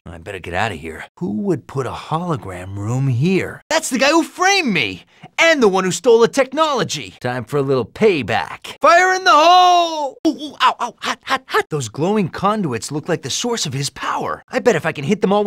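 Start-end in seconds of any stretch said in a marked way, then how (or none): 3.7-7.1 indignant recognition. Acted. Recognising the man who framed him. 8.8-10.2 very high, loud, long-held call; warning shout. Acted. Shouted warning while attacking. 10.3-11.7 quick, high yelps of pain; burnt yelping. Acted. Hero scorched by fire, high pained yelping. Getting burned.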